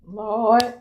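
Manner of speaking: normal talking voice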